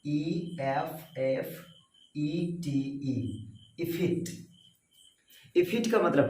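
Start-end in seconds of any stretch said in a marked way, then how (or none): 0.1-4.5 soft slow spelling. Shown as a demonstration. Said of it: "मेरे साथ बोलेंगे".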